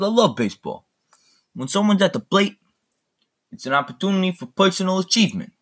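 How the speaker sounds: high-pitched mob boss speech; Robert De Niro. Retold or imitated. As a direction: imitate a gangster addressing his men at dinner. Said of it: "It's Robert De Niro".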